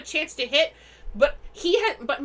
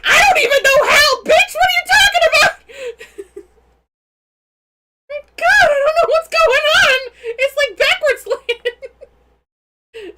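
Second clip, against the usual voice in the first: real shout, much higher and louder, cracking with laughter; laughing shout; 13 semitones higher, 12 dB louder than usual. Real, not acted. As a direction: yell it in helpless, laughing disbelief. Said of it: "I'm too loud".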